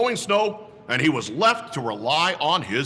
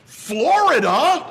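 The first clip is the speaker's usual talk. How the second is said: slow, loud, high astonished question; both friends stunned by the news; exclaimed; 11 semitones higher, 7 dB louder, slower than usual. Acted. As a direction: stretch it out in disbelief. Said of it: "Ernie and Ralph both exclaimed".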